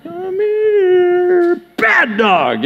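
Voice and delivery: high, squeaky, stretched call to a dog; sugary mock pet-calling voice; coaxing a pet. Shown as a demonstration. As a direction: coo it high like luring a dog. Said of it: "gotta coax your, you know, your cat or your dog".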